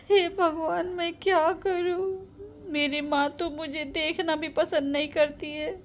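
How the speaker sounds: acted weepy despair; tearful lament. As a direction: lament as if on the verge of crying. Daughter laments tearfully to God.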